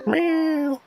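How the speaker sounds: high, drawn-out meow; cat meow. Pretend. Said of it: "That's why he meowed at you".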